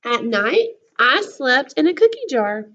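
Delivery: lively storybook voice, big swings of pitch; reading to children. A narrator's reading. Teacher reading a book to her class, lively storybook voice. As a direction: read it to the class like a picture book.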